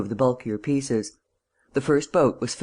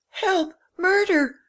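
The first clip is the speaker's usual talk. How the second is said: much higher, panicked cry for help; terrified shout; much slower than usual. A narrator's reading. The valet to the intruders, a high terrified shout. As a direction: scream for help as if caught by burglars. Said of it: "shouted the man".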